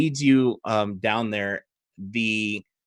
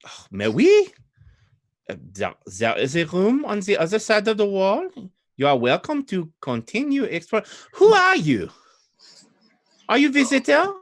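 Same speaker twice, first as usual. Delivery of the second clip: put-on high, swooping French-accented lady; 9 semitones higher than usual. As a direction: question the visitor in a high, heavy French accent. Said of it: "French in this case would be Old Adari".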